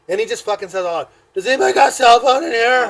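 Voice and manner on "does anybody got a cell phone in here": put-on loud, raised drunken holler; calling out. Drunk man asking the whole bus for a phone, hollering. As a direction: yell it to the whole room.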